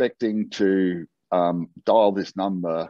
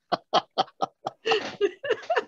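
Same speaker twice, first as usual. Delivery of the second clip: high delighted laugh; real laugh, pitched way up; high burst of laughter; 4 dB quieter than usual. Real, not acted. Laughing at the mix-up.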